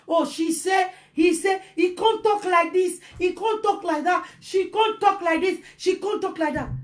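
high, singsong mimicry of complainers; mock chattering he-said-she-said voice; mocking gossip. Mimics gossip people listen to.

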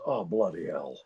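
low, weary Scottish star; Sean Connery. Retold or imitated. Sean Connery reacting to the martini joke, exasperated. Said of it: "and Sean goes".

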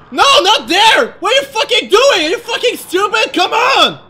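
loud, high, rapid-fire scolding; real exasperated shouting at the game; frantic angry yelling. Real, not acted. Yelling at game during a chase.

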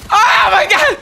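high, loud cry of disbelief; real shocked yell; shocked scream. Real, not acted. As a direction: scream it like you just got killed. Shocked gamer death scream.

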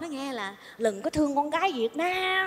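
soft confidential aside; hushed gossip; secretive hush. Acted. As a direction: pass it on quietly, as if afraid of eavesdroppers. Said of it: "sợ người ta nghe".

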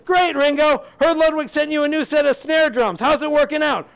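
acted high holler; shouted small talk. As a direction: yell it chattily. Shouted exchange with Ringo.

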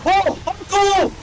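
very high-pitched cartoon exclamations; cartoon voice-actor impression. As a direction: squeal it like a cartoon hero. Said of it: "he sounds like that".

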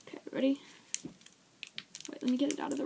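soft whispered question; whispering. Real, not acted. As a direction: whisper it, checking they're ready. Countdown before eating candies.

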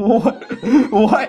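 high, laughing double take; laughing disbelief. Real, not acted. Viewer reacting to the clip's comeback, laughing in disbelief. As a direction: crack up while asking in real bafflement.

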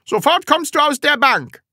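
high, loud schoolmaster order; sharp command. Acted. Teacher to new pupil in the wrong seat, commanding.

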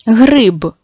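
гриб said clearly with stress on the only syllable. Shown as a demonstration. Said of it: "Не забудьте поставити наголос". (наголошено Гриб)

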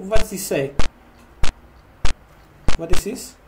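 normal talking voice

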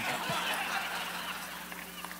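a high, airy burst of real laughter; high breathless laugh. Real, not acted. Preacher laughing at his own Guatemala story, high airy laugh. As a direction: laugh freely at the punchline.

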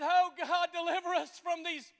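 loud, high plea to God; crying out. Acted. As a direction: beg God for rescue, loud. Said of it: "that said".

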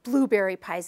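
ordinary talk, nothing marked

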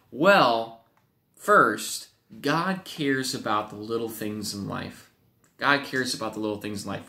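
ordinary talk, nothing marked

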